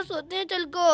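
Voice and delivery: normal talking voice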